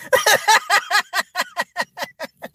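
long, high cackle; cackling laughter. Real, not acted. Cracking up over Forrest's kid joke, high cackle. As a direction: laugh hard in a rising staccato burst.

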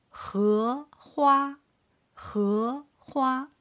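荷花 with 荷 rising, 花 held high and level; second-tone demo. Shown as a demonstration. Teacher models second tone for learners, 荷花 rising then high level. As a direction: carefully lift 荷, then hold 花 level. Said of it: "Starting from second tone".

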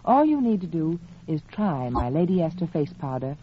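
ordinary talk

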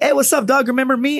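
high-pitched retold taunt; mock-friendly greeting. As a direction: sing out a needling hello to an old foe.

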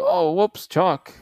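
hammed-up guy caught readjusting; mock innocent.

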